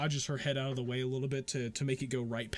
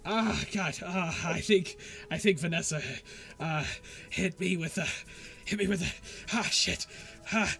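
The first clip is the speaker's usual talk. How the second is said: high, loud excited rambling; the jittery wizard explains the spell Vanessa hit him with, high and excited; over-excited babble; 6 semitones higher, 3 dB louder than usual. Acted. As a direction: babble on, buzzing with energy. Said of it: "He's like".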